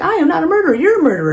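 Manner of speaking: loud, very high mock-indignant retort; arguing back. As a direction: throw the accusation back, hammed-up. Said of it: "as they're arguing".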